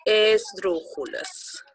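esdrújulas stretched out syllable by syllable; esdrújulas slowly, syllable by syllable; slow, drawn-out esdrújulas. Shown as a demonstration. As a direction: draw out esdrújulas for the class. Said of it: "se le denominan".

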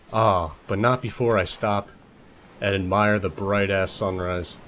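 usual speaking voice

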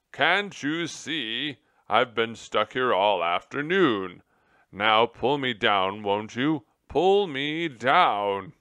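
loud, indignant, drawn-out demands; furious. A narrator's reading. General stuck upside down demanding rescue, furious. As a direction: shout it slowly, exasperated. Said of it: "the general said furiously".

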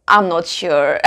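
low, hesitant answer with a small laugh; unsure artist. Acted. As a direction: lightly play a stumped artist, voice dropping. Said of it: "you will get an answer".

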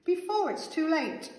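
high, urgent plea; pleading call. A narrator's reading. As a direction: beg before time runs out. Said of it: "called the veggies".

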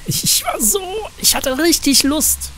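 high, slow gushing; giddy eagerness. Real, not acted. Player admits the urge to be rude, high gushing. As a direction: sound almost giddy with temptation.

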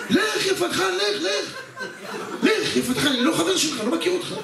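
high-pitched, hammed-up scolding; indignant brush-off. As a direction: shoo him away in a high, embarrassed yelp. Disowning the shouting friend, acted quarrel. (גירוש נרגז ומוגזם בקול גבוה)

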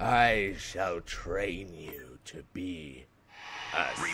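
low, slow, soft Sith master; Sith lord voice. Acted. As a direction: intone it darkly, slowly.

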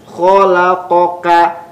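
"Khalaqaka" slow, every letter short; clear slow letters. Shown as a demonstration. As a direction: spell out "Khalaqaka" slowly, nothing long. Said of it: "Jelas pelafalannya". ("Khalaqaka" dibaca pelan dan jelas)

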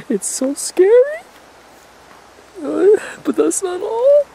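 fake-cry it in a high, slow, panicked wail; mock terrified crying. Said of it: "I'm really fucking scared right now".

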